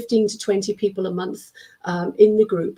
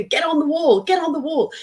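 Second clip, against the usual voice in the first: urging teacher call; 7 semitones higher than usual. Retold or imitated. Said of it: "I say".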